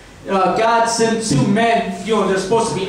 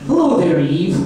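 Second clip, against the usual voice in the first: put-on snake voice, dropping on the name; disguised voice; 4 semitones lower than usual. Adam hidden in bushes greets Eve, in a disguised voice. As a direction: disguise your voice to greet her. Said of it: "Adam disguises his voice".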